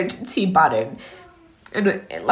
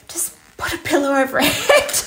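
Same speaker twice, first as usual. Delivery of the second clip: laughing whisper; 6 semitones higher than usual. Retold or imitated. Dark joke whispered.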